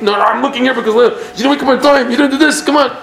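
high, fast, exasperated parent; worked-up mock scolding, pitched high; nagging parent. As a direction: brush off the praise and scold. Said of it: "the parent is like".

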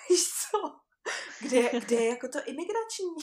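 high, loud burst through laughter; laughing while retelling. Real, not acted. Traveller retelling her hunt for the immigration desk, laughing. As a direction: laugh out the bewildered question.

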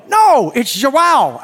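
loud, slow, insistent No, it's João; exasperated correction. Retold or imitated. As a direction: correct him sharply, drawing out João. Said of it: "And they go".

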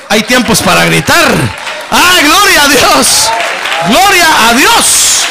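high, loud, ringing scripture proclamation; fiery preaching. Acted. Pastor reads the promise to the congregation in full preaching voice. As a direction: lift the verse up over the crowd.

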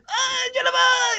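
very high mock cry of the name; hammed-up, anguished howl on the name; imitated movie wail. Showing how David sounds in the film, mock wail. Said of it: "just like in this".

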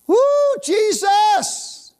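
high, loud, slow shout of praise; ecstatic cry. Real, not acted. Preacher crying out in praise, ecstatic loud cry. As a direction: cry out in joy.

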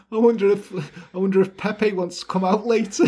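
acted line dissolving into giggles; giggling quote. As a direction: voice the character while trying not to laugh. Acts out George while giggling.

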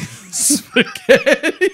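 put-on very slow, high, laughing Russian accent; fake Russian accent. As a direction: stretch it out, cracking up. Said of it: "I only speak Russian now".

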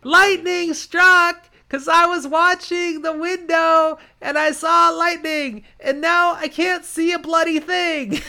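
very high, loud half-deaf shouting. Acted. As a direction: shout over ringing ears. Deafened Matt shouting.

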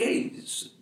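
normal talking voice

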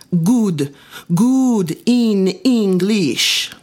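put-on slow, proud Italian accent; earnest Italian accent; thick Italian accent. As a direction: stretch the words in heavy Italian. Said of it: "His Italian accent was so thick".

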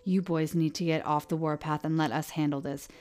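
loud, stern fatherly order; angry command. Acted. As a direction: slam down the final word. Sheriff slams desk, lays down law.

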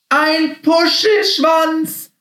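very high, slow squealed shout; very loud cry. A narrator's reading. Happy spots a fluffy tail, crying out loudly. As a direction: cry out the discovery, stretched and high. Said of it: "rief er ganz laut".

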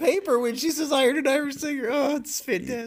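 very high, delighted squeal; cracking up. Real, not acted. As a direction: squeal it through real amusement. Delightedly recalling the funny scene.

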